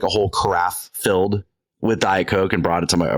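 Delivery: ordinary talk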